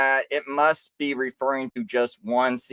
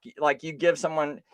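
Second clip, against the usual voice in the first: usual speaking voice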